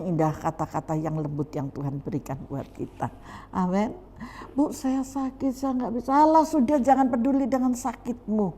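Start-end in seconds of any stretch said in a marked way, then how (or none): 4.5-6.1 high, put-on ailing plea; mock sickly excuse. Member tells pastor they are sick, mock ailing excuse. Acts member pleading sickness. 6.1-8.5 high, louder acted dismissal; dismissive brush-off. Preacher answers a sick member's excuse, dismissive brush-off.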